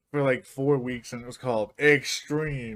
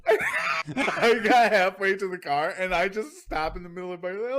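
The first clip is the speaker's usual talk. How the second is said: laughing; 5 semitones higher than usual. Real, not acted. Laughing while retelling.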